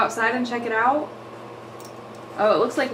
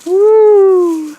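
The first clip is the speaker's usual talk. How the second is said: high, loud whoop; excited cheer; 10 semitones higher, 12 dB louder than usual. Real, not acted. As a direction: cheer her on as she swings. Baby on new swing.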